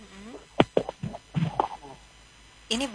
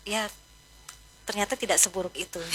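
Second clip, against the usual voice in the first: normal talking voice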